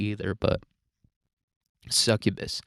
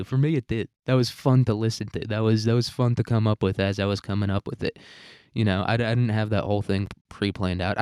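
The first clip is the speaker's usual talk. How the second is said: ordinary talk, nothing marked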